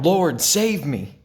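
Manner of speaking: a narrator's earnest cry for help; cried out. A narrator's reading. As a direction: call out for rescue as you sink. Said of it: "he cried out".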